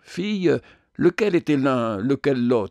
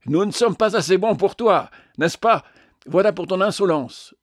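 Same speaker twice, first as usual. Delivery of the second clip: higher, louder angry outburst; furious shout, high and loud; angry cry; 6 semitones higher, 4 dB louder than usual. A narrator's reading. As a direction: lash out at the little man. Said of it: "s'écria".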